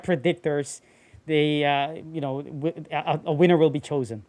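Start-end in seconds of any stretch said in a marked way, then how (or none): none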